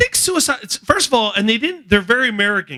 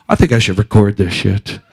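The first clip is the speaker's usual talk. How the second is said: lower, deadpan mock neighbor girl; calmly decide to film it; 9 semitones lower, 3 dB louder than usual. Voicing the girl on porch.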